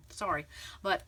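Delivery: ordinary talk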